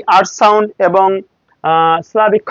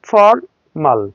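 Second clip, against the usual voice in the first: ফর্মাল slow, split after an American R; American R in formal; 2 semitones lower, much slower than usual. Shown as a demonstration. English teacher models American formal, R sounded. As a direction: break American ফর্মাল as for-mal. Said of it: "আমেরিকানরা ফর্মাল".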